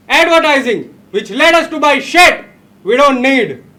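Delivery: shouted at full force like a rallying speech; fiery rant. Acted. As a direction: rouse the crowd, yelling every line.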